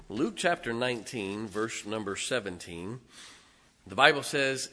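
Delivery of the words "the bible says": ordinary talk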